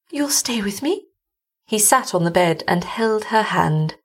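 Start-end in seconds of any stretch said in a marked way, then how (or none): 0.1-1.0 high, timid frightened woman; frightened young wife. Acted. Frightened wife asks husband.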